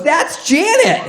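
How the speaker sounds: high, sing-song introduction; chirpy mom. Retold or imitated. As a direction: brightly announce the name up high. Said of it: "My mom goes".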